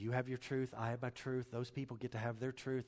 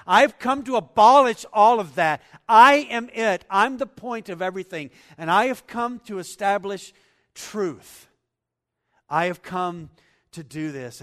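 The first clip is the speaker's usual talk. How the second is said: much louder, higher proclaiming; preaching shout; 8 semitones higher, 18 dB louder than usual. Acted. Preacher shouting Jesus' words to congregation, full force. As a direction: thunder it out to the room.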